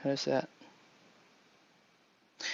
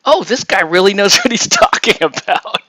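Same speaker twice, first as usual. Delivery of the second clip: mock-impressed viewer, higher and louder; impressed listener imitation; 7 semitones higher, 6 dB louder than usual. YouTuber mocks people fooled by a smart accent, mock-impressed. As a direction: imitate an awed fan, voice lifted and loud. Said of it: "and people would go".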